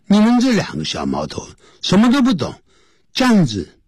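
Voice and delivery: high-pitched telling-off; acted reprimand from a doctor; scolding doctor. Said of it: "就来这个教训我们".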